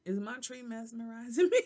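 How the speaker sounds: giggling at herself; laughing. Real, not acted. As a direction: laugh through the question.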